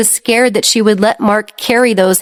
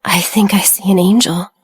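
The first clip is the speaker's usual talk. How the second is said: trembling, hoarse whisper. Acted. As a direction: mumble it barely awake, hoarse. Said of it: "Her voice was hoarse and trembling".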